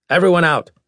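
high, loud order; commanding shout. Acted. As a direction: clear the room sharply. Doctor orders room cleared.

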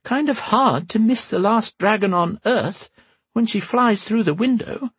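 put-on high creaky old empress, dry; old woman voice. A narrator's reading. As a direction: tart old woman's retort. Whisper's voice held.

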